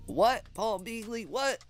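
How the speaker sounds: ordinary talk, nothing marked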